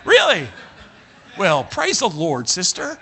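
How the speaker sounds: high, loud, syrupy charm, slow on praise the Lord; gushing Really, then a drawn-out praise the Lord sister; turning on the charm. Retold or imitated. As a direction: lay the preacher charm on thick. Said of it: "turned on my charm then".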